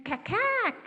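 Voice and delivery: high sharp cack-cack; magpie call. Shown as a demonstration. Storyteller shows the magpie's call to the children. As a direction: chatter like a magpie. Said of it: "Can you say".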